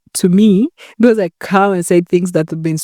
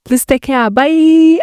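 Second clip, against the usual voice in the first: bright goodbye; 8 semitones higher, slower than usual. Real, not acted. Cheerful vlog sign-off.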